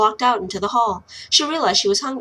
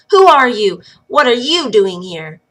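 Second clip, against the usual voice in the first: loud, chin-up demand of a stranger; defiant challenge; 2 semitones higher, 8 dB louder than usual. Acted. Lil loudly challenges the wild woman in the hall, defiant. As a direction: stand your ground and call it out loud.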